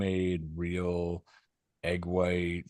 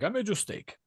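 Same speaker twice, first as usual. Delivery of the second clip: cheerful neighbor voice; 11 semitones higher than usual. Retold or imitated. Jose voiced.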